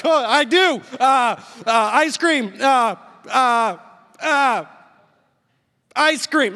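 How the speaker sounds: loud, high, helpless stammering; flustered panic. Retold or imitated. Husband caught out by his crying wife, flustered panic. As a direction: blurt it out, lost for anything better.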